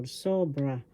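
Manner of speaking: sobra with SO stressed, falling away; teacher modelling sobra, accent on SO; stress on first syllable. Shown as a demonstration. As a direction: say sobra, leaning on the opening syllable. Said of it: "The accent is in the first syllable".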